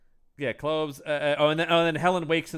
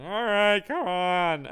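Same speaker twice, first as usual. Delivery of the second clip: Ray Romano; 6 semitones higher, slower than usual. Acted. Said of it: "Ray Romano's trying to sleep".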